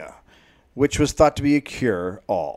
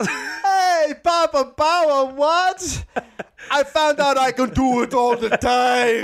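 high, slow spooky ghost voice; 12 semitones higher, much slower than usual. Acted. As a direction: play the ghost with a goofy high voice. Ghost voiced in podcast bit.

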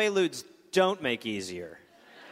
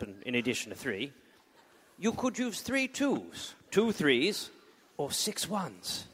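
butcher's voice; 5 semitones lower, 4 dB quieter than usual. Acted. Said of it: "but in a butcher's voice".

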